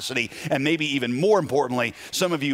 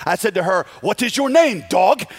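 put-on high, loud Ukrainian-accented English; thick Ukrainian accent; 5 semitones higher, 5 dB louder than usual. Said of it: "imagine it in the thick Ukrainian accent".

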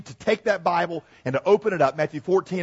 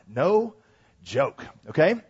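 ordinary talk

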